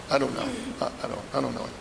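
flustered, fading stammer; stammering denial. Acted. Peter denies, stammering.

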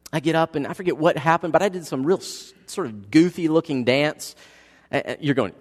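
ordinary talk

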